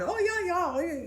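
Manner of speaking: high, loud mock yeah-yeah; high breezy mock agreement; mock absent agreeing. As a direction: imitate a distracted friend nodding along. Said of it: "she's usually going".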